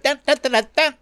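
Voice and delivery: high sung-out ringtone "dun dun dun"; high, staccato ringer imitation; imitated phone ringer. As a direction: mimic an annoying phone alert. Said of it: "when you hear that".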